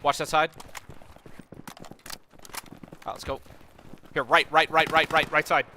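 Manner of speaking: loud urgent callouts; cover me shout. Real, not acted. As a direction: shout orders over gunfire. Combat callouts to squad.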